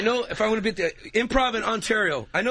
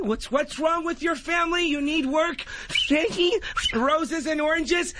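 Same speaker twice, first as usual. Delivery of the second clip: toothless whistling speech; 10 semitones higher than usual. Acted. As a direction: whistle through missing teeth as you talk. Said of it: "He whistles when he talks".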